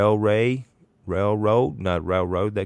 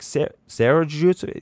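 put-on higher, louder Long Island cabbie accent; 9 semitones higher, 6 dB louder than usual. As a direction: do the Long Island taxi driver, surprised. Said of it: "real strong sort of Long Island accent".

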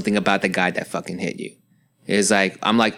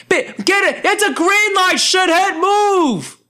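mock the jerk honking behind you; rude impatient driver; slower than usual. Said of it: "every car that would just like".

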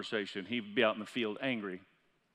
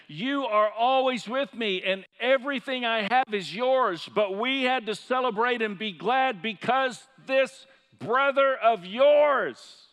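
pleading father; 9 semitones higher, 8 dB louder, slower than usual. Retold or imitated. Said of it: "the father said".

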